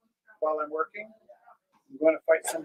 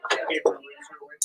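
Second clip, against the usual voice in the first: whisper; 9 semitones higher, slower than usual. Real, not acted. Hushed confession of a funny story.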